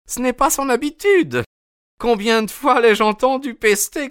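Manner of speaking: high, fretful woman's voice; a wife anxious about her missing husband; worried landlady. A narrator's reading. Mrs Smith voiced. (femme inquiète, voix aiguë)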